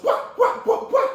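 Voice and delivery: short high loud "wah" yelps; rapid kung fu shouts, high-pitched; fighting cries. Acted. Master fends off flying swords, high fighting yelps. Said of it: "Shifu goes".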